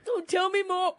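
high-pitched swooning imitation; mocking fake fascination; mock gushing. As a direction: imitate someone hanging on every word, pitched high. Mocking co-host's gushing interest.

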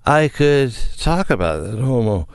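put-on slurred, sloppy drunk talk. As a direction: slur it like you're hammered. Drunk voice quoting politician.

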